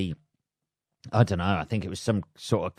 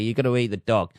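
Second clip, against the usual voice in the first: ordinary talk, nothing marked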